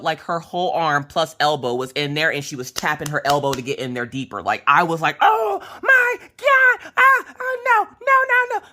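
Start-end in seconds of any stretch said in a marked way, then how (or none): 5.2-8.7 high, loud, drawn-out wail; hammed-up cry of agony and protest; panicked wailing. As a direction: wail in panic, begging it to stop. Acted-out panic during the nasal swab.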